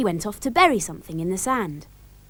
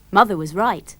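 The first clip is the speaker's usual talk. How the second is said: ordinary delivery, nothing marked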